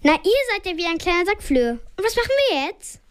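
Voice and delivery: a nervous stranger waving her hands; woman in the stuck lift cries out, hectic; hectic woman calling. A narrator's reading. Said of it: "wedelt so ganz hektisch mit der Hand und ruft".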